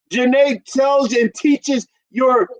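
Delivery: ordinary talk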